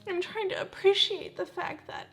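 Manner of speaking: high tearful voice breaking into whisper; tearful, words squeezed out high; trying not to cry. Real, not acted. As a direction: choke back real tears as you talk. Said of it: "I'm trying not to cry".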